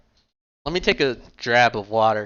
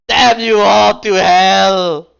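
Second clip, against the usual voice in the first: hammed-up howl of despair, much higher and louder, very slow; desperate dramatic cry; 8 semitones higher, 12 dB louder, much slower than usual. Rook mourning the eaten tacos, hammed-up anguished howl. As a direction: wail it like a ruined hero at the heavens. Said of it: "dramatically raises his arms in desperation".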